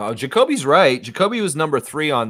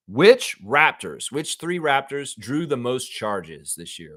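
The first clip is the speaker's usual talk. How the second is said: slow question read; slower than usual. Shown as a demonstration. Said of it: "reads the question slowly".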